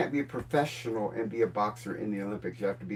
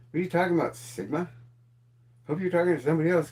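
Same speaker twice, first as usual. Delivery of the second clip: usual speaking voice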